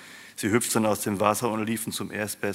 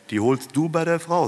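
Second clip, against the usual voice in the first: much higher, louder, rising instruction; instructing; 8 semitones higher, 5 dB louder than usual. A narrator's reading. Opa sends Mike to the woman, high-pitched instructing. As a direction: push the errand onto him in a raised voice.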